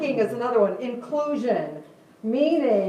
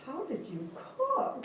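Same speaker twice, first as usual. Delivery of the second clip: slow, high-pitched mock-kid question; puzzled kid; 8 semitones higher, 8 dB quieter, much slower than usual. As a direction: lightly imitate a baffled child, higher and softer. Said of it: "And one of them goes".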